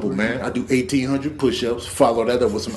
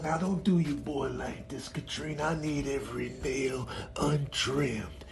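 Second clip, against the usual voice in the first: whisper plea; 14 dB quieter than usual. Acted. Cat begging owner to spare its claws, whispering. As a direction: beg in a breathless whisper.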